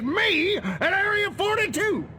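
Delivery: indignant high burst; outraged. Acted. General recounts assassination attempt, outraged.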